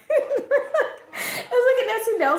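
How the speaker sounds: high voice breaking up with laughter; tickled by the absurd picture; laughing. Real, not acted. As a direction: squeak it out through the giggles. Laughing at the imagined scene.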